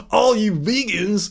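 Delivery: slow, put-on troll voice; Meathead Trolls. Streamer mimicking anti-vegan trolls, slow put-on voice. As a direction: do a drawn-out heckler of vegans. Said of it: "my Meathead Trolls impression".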